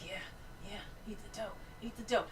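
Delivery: soft, hushed egging-on; quietly will someone on, under the breath; murmured urging. Retold or imitated. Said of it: "Like, I'm like".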